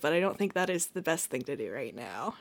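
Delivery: ordinary talk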